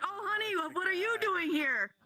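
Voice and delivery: husband caught, surprised and stammering; caught off guard. Acted. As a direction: squeak it out, startled. Husband caught by his wife.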